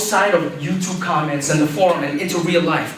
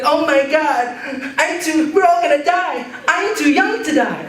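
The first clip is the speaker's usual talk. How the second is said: mock-hysterical old lady, much higher; panicked old lady; 10 semitones higher than usual. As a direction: squeal like you're sure you'll die.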